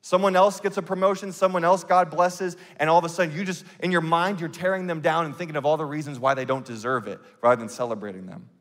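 normal talking voice

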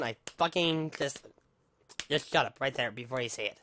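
ordinary talk

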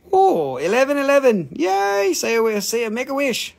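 thrilled high voice calling for a wish; excited. Real, not acted. Delight at the time 11:11.

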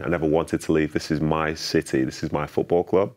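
normal talking voice

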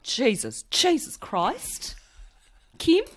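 high, slow Australian sitcom exclamation; Kath and Kim. Acted. Replaying a favourite sitcom moment, exaggerated Australian accent. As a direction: draw it out high in comic Australian. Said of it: "my favourite Kath and Kim moments".

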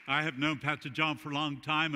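ordinary delivery

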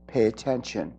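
low, hushed, soft-spoken urging; soft inner voice. Retold or imitated. The speaker voices her guides' hushed inner voice. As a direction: murmur it like a gentle inner voice. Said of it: "this other voice in the background was saying".

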